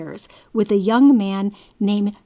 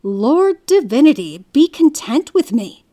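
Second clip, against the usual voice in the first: louder, raised-pitch plea to God; crying out; 4 semitones higher, 6 dB louder than usual. A narrator's reading. Sofian calls on his Lord in reply, crying out. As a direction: cry it out, narrator's measured appeal. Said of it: "Sofian cried out".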